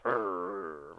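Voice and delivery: low, soft animal growl; a warning growl from the dog, low in the throat. Acted. Griff warning Wiglaf of the hidden cultists, low soft growl. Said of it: "he hears Griff growl".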